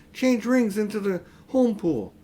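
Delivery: put-on urgent high girl's plea. A narrator's reading. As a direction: hurry him along urgently. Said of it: "said Polly".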